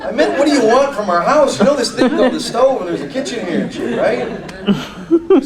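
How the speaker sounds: high, loud mock outburst, half laughing; mock exasperation. Acted spat over dinner with partner.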